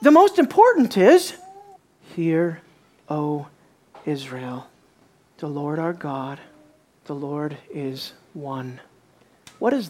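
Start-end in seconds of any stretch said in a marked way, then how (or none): 0.0-1.3 eager answer. Retold or imitated. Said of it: "Jesus says". 2.1-8.8 softer, slow and reverent; hushed, reverent recital; solemn recitation. Retold or imitated.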